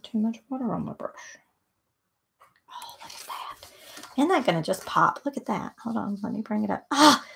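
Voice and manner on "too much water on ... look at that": whispered wonder. Real, not acted. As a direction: hush it out in admiration. Admiring the painted color.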